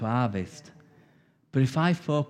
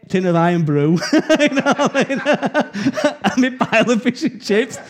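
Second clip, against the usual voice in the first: higher, louder, laughing through the words; 8 semitones higher, 5 dB louder than usual. Real, not acted. Preacher telling the congregation his Irn-Bru story, laughing. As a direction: chuckle as the memory tickles you.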